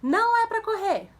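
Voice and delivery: put-on high, loud warning to a child; parent's shouted command. Shown as a demonstration. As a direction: call out sharply to stop a running child. (bronca gritada, aguda e alta)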